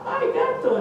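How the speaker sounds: terrified bravado breaking into a laugh; father losing his nerve at the cat's eyes; panicky squeak. Acted.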